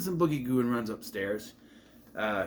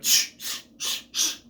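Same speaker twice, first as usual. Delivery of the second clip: mock shushing; slower than usual. Shushing clapping kids, repeated shushes. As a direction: run of short "shh" sounds. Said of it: "quieter than your shh".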